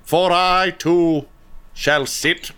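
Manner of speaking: high, slow royal decree; regal king. Acted. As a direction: proclaim it like a pompous king. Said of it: "he's like".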